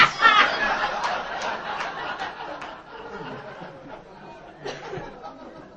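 high airy laugh, long and rising; high breathy laughter. Real, not acted. Speaker laughs delighted at the author's line.

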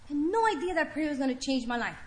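choked up. Real, not acted. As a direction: let the words catch in your throat. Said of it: "It still gets me".

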